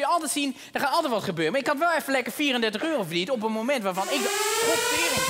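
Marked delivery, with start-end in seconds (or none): 4.7-5.3 startled curse. Acted. Alarm suddenly goes off mid-thought, startled high curse. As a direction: jolt into a high-pitched curse.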